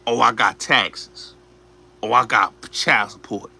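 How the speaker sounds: switched excuse tone. Retold or imitated. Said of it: "he switched his tone".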